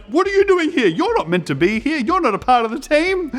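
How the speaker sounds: high, loud challenging call; stern referee. Retold or imitated. Referee calling out a boy who wandered onto the field. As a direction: demand to know who this intruder is. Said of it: "I was so nervous when he was like".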